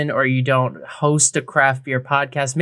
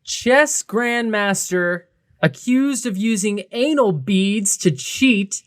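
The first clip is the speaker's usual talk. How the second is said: headline read; 5 semitones higher, slower than usual. Real, not acted. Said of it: "I'm just gonna read the headline".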